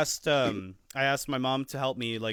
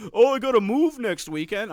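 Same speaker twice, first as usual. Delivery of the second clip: higher, louder, slow pleading hint; a higher, slower mock of a friend angling for movers; 8 semitones higher, 7 dB louder than usual. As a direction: drag it out like a friend fishing for help. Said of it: "Somebody's like".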